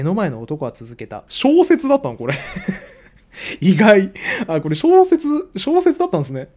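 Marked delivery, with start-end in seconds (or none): 1.3-2.3 sudden high, loud surprise; a real double take at the book; 小説だったの？ said high and loud, genuinely caught off guard; surprised. Real, not acted. 4.5-6.5 surprised realization. Real, not acted. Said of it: "そういうテンションで読んでなかった".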